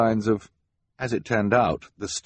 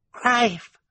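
put-on high baby squeal, much higher; baby babble. Baby Sunny babbles a word.

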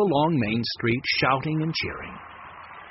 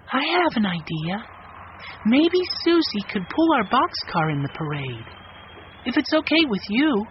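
put-on young girl voice; 8 semitones higher than usual. A narrator's reading. Violet voiced by reader.

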